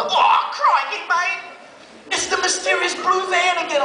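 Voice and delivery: teen spotting the blue van again, mock alarm. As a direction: play it as comic panic. Alarmed inner thought.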